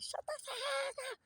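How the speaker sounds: very high, softened, drawn-out mock scream; mock yelling. Showing how they act at home at loud noises, mock yelling. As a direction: imitate screaming at a noise with the volume held back. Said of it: "loud noises or something".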